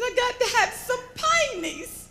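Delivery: high-pitched, urgent country plea; imitating a good old boy in a panic; panicked farmer. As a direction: insist frantically like a flustered farmer. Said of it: "was in a little bit of a panic".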